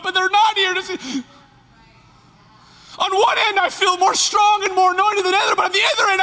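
loud, high, impassioned preaching; full-force preaching. Acted. Pastor preaching to his church at full force. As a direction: build the sermon to a cry.

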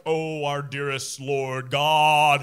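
booming, drawn-out mock pulpit prayer; hammed-up preacher intoning to the Lord; theatrical prayer voice. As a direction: imitate a pastor praying grandly, loud and very slow. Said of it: "do this really theatrical voice".

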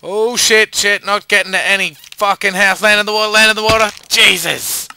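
loud, high frantic cursing; real panic shout; panicked yelling. Real, not acted. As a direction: yell it as you fall. Falling, panicking mid-jump.

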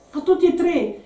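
high, insistent comeback; pressing retort. A narrator's reading. Woman pressing her companion about the messages, insistent retort. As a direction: press the point, pitched well up. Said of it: "incalzò Bianca".

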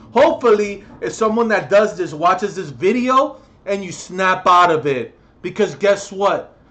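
loud, furious shouting at abusive parents; angry rant. Real, not acted. Ranting at abusive parents on camera, loud furious shouting. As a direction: yell it at them, really furious. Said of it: "I'm getting too annoyed".

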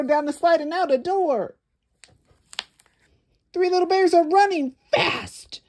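breathless chase excitement; excited climax. A narrator's reading. Storyteller excitedly racing through the escape.

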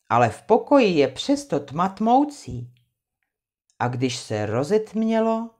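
plain reading voice

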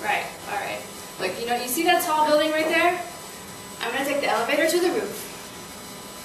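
ordinary talk

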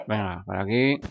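ordinary talk, nothing marked

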